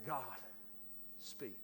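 quiet, intimate prayer; hushed prayer. Retold or imitated. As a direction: barely breathe the words as a private prayer. Retold quiet prayer at desk.

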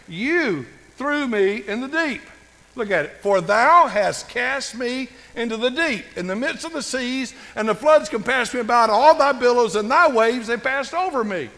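high, loud scripture reading; ringing sermon delivery; impassioned preaching. Acted. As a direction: proclaim the verse from the pulpit, leaning on the key words. Proclaims Jonah's verse.

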